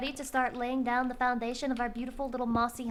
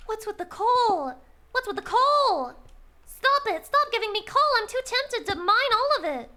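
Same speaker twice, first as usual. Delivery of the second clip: mock outrage; 9 semitones higher, 7 dB louder than usual. Streamer protesting tempting coal to chat, in mock outrage. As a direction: protest loudly, pitch shooting up.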